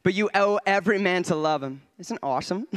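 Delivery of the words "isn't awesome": ordinary talk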